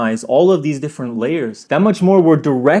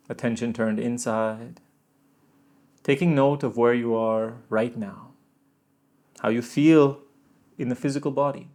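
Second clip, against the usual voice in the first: guided meditation; 4 semitones lower, 7 dB quieter than usual. Acted. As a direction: settle them inward gently.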